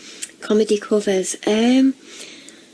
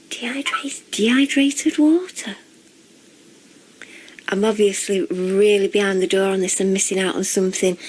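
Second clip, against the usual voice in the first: normal talking voice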